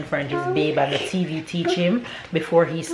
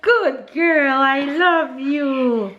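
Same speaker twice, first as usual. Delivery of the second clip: high, slow, sing-song praise; 9 semitones higher, much slower than usual. Real, not acted. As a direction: coo proudly to a little one, stretching the vowels. Praising and loving on her toddler.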